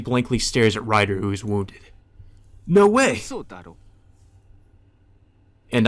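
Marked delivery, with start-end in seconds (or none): 2.6-3.2 high, loud disbelief; shocked Shinji. Acted. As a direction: cry out in shock. Shinji sees Ryder beaten.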